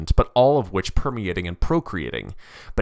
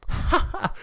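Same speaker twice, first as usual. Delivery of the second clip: high breathy "ha ha"; short acted mocking laugh; derisive laugh. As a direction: laugh in scorn at disbelief. Dante laughs at disbelief.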